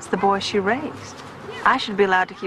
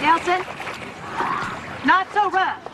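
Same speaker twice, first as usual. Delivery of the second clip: raised voice calling across the yard; 10 semitones higher, slower than usual. Acted. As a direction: call out a warning to the kid. Mother calls to playing boy.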